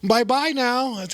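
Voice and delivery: put-on high, drawn-out, sweet-old-lady goodbye; old lady's farewell. Old lady leaving her new seatmate, imitated high farewell. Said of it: "then she leaves, and she's like".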